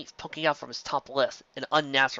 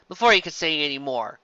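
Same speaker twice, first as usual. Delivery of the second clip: ordinary delivery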